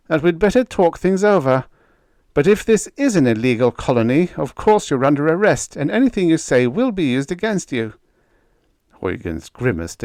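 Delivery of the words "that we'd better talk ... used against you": high, loud official warning of arrest; stern officer Roan. Acted. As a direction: lay down the law, loud and high.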